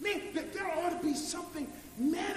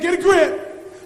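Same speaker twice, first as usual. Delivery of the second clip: firm command; 16 dB louder, slower than usual. Retold or imitated. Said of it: "Jesus said".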